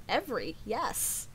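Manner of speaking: normal talking voice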